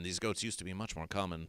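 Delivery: a quiet mock-mournful echo; hushed repeat.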